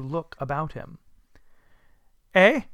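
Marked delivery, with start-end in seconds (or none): 2.3-2.6 short, puzzled "eh"; reply in sheer bafflement; wondering boy. Acted. Boy in Sunday clothes hailed from above, wondering reply. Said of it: "returned the boy with all his might of wonder".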